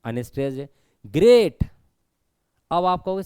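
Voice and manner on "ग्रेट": ग्रेट said loud with a long vowel; stressed ग्रेट, loud and held; stressed long sound. Shown as a demonstration. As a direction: punch ग्रेट hard and draw out its middle. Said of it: "लंबा sound आ रहा है".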